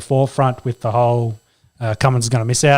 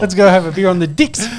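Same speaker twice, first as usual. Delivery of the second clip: teasing mock Kiwi, decks as dicks; Kiwi accent; 6 semitones higher than usual. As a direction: mimic a Kiwi vowel for a laugh. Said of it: "I said it in a Kiwi accent".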